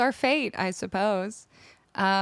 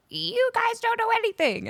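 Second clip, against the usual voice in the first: high-pitched mocking quote; mock indignant commenter; 14 semitones higher than usual. Streamer quoting the other half of commenters, high mock indignation. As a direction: imitate a scornful commenter, pitched way up. Mocks commenters in an online argument.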